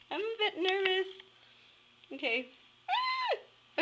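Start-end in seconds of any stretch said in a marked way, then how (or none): 2.9-3.8 high frightened yelp; sudden high shriek of nerves; scared squeal. Real, not acted. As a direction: squeal in real fright at the fish. Nervous squeal grabbing fish.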